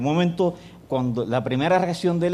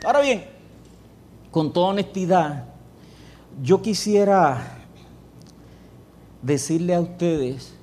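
preaching emphasis; 4 semitones higher than usual. Acted. Preacher turns to congregation.

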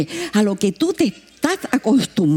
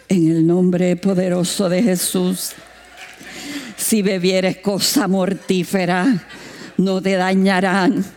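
mock prayer; 4 semitones lower, slower than usual. Retold prayer before drinking.

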